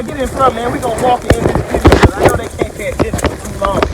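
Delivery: ordinary talk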